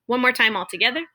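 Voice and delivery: usual speaking voice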